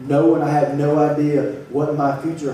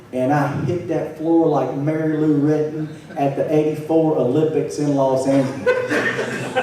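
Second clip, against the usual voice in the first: ordinary talk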